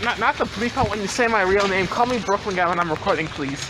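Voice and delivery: ordinary talk, nothing marked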